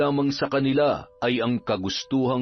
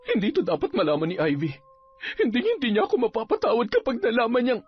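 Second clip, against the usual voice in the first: higher, fast anxious woman's voice; worried woman; 11 semitones higher, much faster than usual. Acted. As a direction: rush the words in fear.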